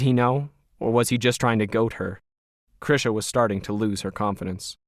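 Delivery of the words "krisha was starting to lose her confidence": usual speaking voice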